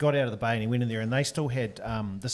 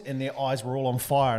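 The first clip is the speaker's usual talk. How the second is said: ordinary talk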